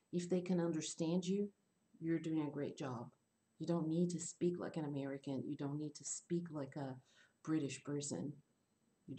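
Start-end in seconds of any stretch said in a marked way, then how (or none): none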